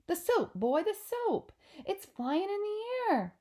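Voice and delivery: high, rising cries of astonishment; father squeaking in amazement; amazed excitement. Acted. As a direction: burst out, pitch soaring. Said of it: "squiffy with excitement".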